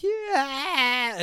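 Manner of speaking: high, drawn-out angry squawk; put-on furious yelp; mock furious cry. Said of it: "she gets furious".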